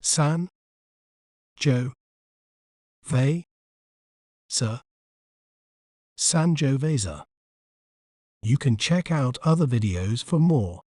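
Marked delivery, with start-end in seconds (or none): 0.0-4.8 "San. Jo. Ve. Sa." one syllable at a time, long pauses; isolated, evenly spaced syllables "San. Jo. Ve. Sa." in American pronunciation; American syllable breakdown. Shown as a demonstration. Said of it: "in American accent". 6.2-7.2 Sangiovese as san-joh-VAY-zuh, American, slow; American "Sangiovese" ending in -zuh; American pronunciation. Shown as a demonstration. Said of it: "in American accent".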